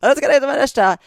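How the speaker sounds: high, loud answering call; imitated office-wide reply, hollered; yelling back. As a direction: yell it back from your desk. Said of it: "kinda yells back".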